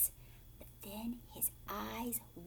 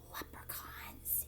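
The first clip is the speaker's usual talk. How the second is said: whispered slow mutter; 14 dB quieter, slower than usual. Acted. Tom blaming leprechauns as he leaves, slow whispered mutter. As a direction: breathe it out in defeat. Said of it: "he muttered".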